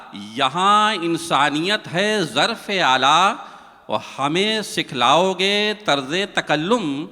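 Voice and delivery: measured, lilting verse delivery; poem recitation. Acted. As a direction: recite it like a mushaira couplet.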